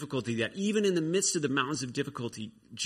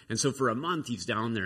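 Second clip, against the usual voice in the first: usual speaking voice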